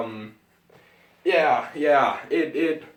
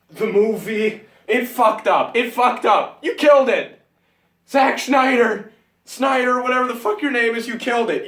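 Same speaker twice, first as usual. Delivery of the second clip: higher, louder furious shouts at the director; furious yelling; 6 semitones higher, 3 dB louder than usual. Real, not acted. Fan yelling at Zack Snyder, furious shouting. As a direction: tear into Zack Snyder, full voice.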